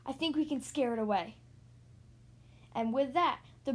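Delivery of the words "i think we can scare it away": usual speaking voice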